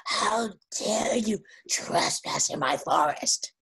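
slow, rough half-whispered monster threat; huge monster. Acted. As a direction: stretch out each word as a menacing monster. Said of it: "this huge monster appears before Krishna".

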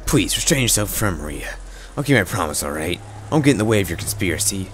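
ordinary delivery, nothing marked

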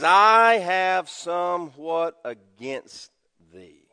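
solemn slow quote. Acted.

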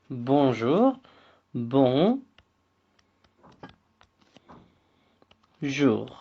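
bonjour split as bon, then jour; slow syllables. Shown as a demonstration. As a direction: bon and jour said apart slowly. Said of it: "Diğer bir kelimemiz".

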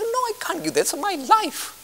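mock pleading. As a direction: whine like someone losing everything. Listener refusing to give up.

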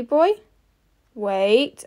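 usual speaking voice

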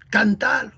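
"Cántalo" stressed on the middle, can-TA-lo; Argentine verb stress. Shown as a demonstration. Teacher giving another Argentinian verb, "Cántalo" stressed as can-TA-lo. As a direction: say "Cántalo" as cantálo, with a long TA. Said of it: "Van cambiando la pronunciación de los verbos".

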